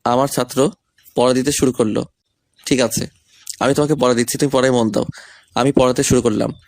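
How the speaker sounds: ordinary talk, nothing marked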